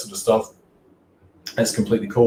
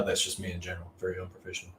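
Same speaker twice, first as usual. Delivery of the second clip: "Very unprofessional" repeated much softer and lower, under the breath; quiet aside; 3 semitones lower, 16 dB quieter, faster than usual. Real, not acted. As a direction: throw "Very unprofessional" away as a self-mocking murmur. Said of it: "Sorry about my, uh, giggling fit".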